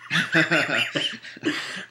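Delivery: amused chuckling laugh; real laugh. Real, not acted. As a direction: laugh it off after a silly tangent. Laughing after silly cookie tangent.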